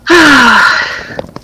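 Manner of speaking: high, drawn-out breathy sigh; long sigh. Acted. Player reacts to a stealth dilemma.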